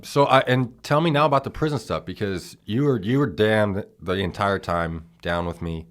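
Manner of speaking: sudden loud, high burst of energy; excited. Real, not acted. Brother bursting in loudly to ask about prison. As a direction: jumps in loud and eager.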